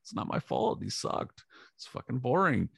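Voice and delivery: high, mock-exasperated retort to the attorney; tossing it off in a high squeaky voice; mock exasperated reply. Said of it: "I was like".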